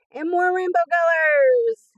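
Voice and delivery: loud and high, bursting with delight; squealing over rainbow stickers; excited shout. Real, not acted. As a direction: shout it out, thrilled by colors. Escalating delight over sticker sheets.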